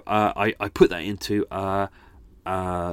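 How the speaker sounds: usual speaking voice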